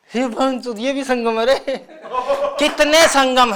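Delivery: high, disbelieving comic outburst; ranting comic. Real, not acted. Comic outburst at repeated name.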